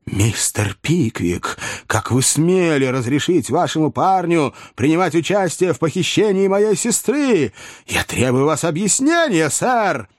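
high-pitched outraged confrontation; indignant demand. Acted. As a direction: demand an explanation, voice climbing high. Brother confronts Pickwick over elopement.

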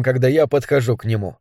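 usual speaking voice

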